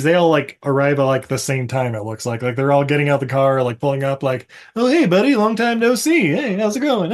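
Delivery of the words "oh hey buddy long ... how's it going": high, chummy hello; higher-pitched mock-cheery small talk; mock friendly greeting. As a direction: greet an old pal, hammed-up. Acted friends greeting on arrival.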